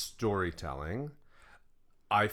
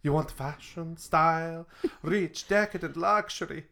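high, swooping melodramatic voice; hammed-up high-pitched dramatic monologue; theatrical film-trailer character; 7 semitones higher than usual. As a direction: play it grandly, like a haughty fashion-house diva. Acted intro skit in film character voice.

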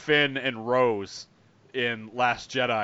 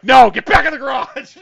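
much higher, loud mock order; mock shout; 11 semitones higher, 15 dB louder than usual. Mocking Finn dismissing Rose, hammed-up shout. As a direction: yell her back into the garage. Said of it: "and it's like".

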